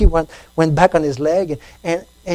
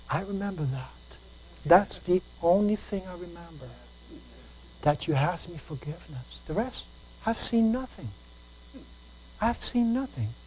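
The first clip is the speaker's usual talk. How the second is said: much softer, calm voice of the Lord; gentle God; 11 dB quieter than usual. Retold or imitated. God reassuring the man he saw nothing, soft and gentle. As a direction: answer kindly and quietly, without blame.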